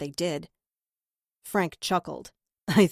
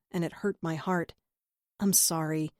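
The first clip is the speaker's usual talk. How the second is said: ordinary delivery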